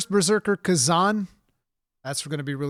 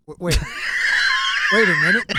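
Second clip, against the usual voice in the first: loud, high, laughing wait a minute; mock outrage; 8 dB louder than usual. Real, not acted. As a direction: act scandalized, laughing. Mock outrage at friend's jab.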